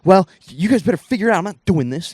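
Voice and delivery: loud, fast mock scolding; hammed-up sulky dad snapping back; upset dad. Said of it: "He's like".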